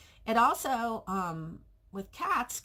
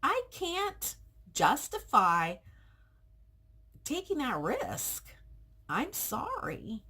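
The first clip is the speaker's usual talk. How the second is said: firm but pleading; 8 semitones higher, slower than usual. Retold or imitated. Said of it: "and I'm like".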